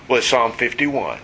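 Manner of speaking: ordinary talk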